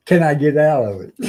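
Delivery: loud, pleading Navy gripe; typical Navy talk. Retold or imitated. Sailor asking his chief to get him out of duty, in Navy talk. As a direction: beg off it like a sailor. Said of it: "Typical Navy talk".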